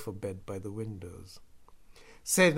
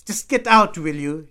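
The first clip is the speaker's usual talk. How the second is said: very loud, high outburst; angry shouted order; 7 semitones higher, 19 dB louder than usual. A narrator's reading. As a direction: order him out at a yell. Drunk brother angrily orders narrator out.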